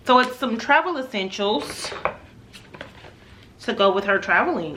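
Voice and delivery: normal talking voice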